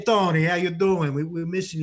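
loud, high-pitched Jersey greeting; Jersey accent. Shown as a demonstration. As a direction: call out in a playful Jersey accent. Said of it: "slide back into my Jersey accent".

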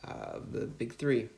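usual speaking voice

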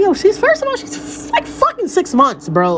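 high-pitched, incredulous protest; incredulous retort. Retold or imitated. Mother protesting to her friend about the baby's age, incredulous. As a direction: push back in disbelief, voice pitched right up.

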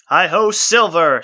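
high, loud, slow rallying cry; called out. Acted. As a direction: cheer it as the beast charges. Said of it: "Strider called".

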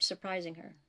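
usual speaking voice

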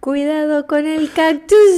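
joke punchline. Pretend. Cactus joke punchline, laughing.

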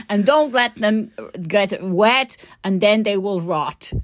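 put-on careful, playful Dutch accent. Dutch grower advising on late tulip planting, Dutch accent. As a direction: imitate a Dutch grower giving advice. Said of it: "shall I do my Dutch accent".

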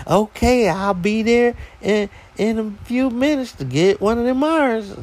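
high-pitched imitation of a woman customer; lady caller. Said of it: "And she goes".

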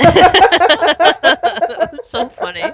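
high, slow words through laughter; real laughter. Real, not acted. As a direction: crack up while saying it. Cracking up at retold joke.

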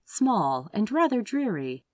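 ordinary delivery

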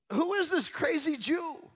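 high, loud mock outrage. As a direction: jeer it like a scornful bystander. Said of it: "they're mocking him".

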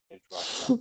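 high, put-on French accent on edge rusher; mock French accent. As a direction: say it like a hammed-up Frenchman. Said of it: "In your French accent".

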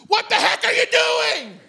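high, loud outburst; hammed-up appalled shout; exasperated yell. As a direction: shout what is really meant. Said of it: "which really means".

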